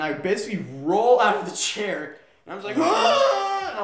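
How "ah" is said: high, loud yelp, half laughing; mock cry of sudden pain; retold yelp of pain. Retold yelp rolling out of chair.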